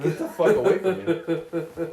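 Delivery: laughing mock recoil. Recoiling from game bodyguard.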